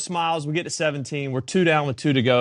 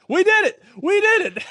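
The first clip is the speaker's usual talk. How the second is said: excited cheer; 3 dB louder than usual. Retold or imitated. Bubba celebrating making Retief smile.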